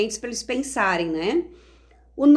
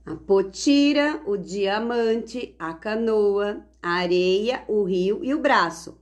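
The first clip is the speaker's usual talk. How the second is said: each key word drawn out slowly; slow word-bank reading; slower than usual. Shown as a demonstration. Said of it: "Está aqui o banco de palavras". (leitura lenta do banco de palavras)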